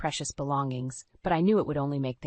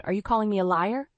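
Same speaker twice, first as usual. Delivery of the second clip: louder, higher indignant demand; angry challenge; 5 semitones higher, 5 dB louder than usual. Acted. Husband bristling at his wife's protest, angry. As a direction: throw the accusation back hard.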